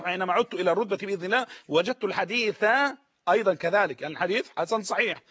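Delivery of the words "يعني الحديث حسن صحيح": ordinary delivery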